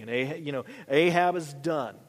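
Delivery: usual speaking voice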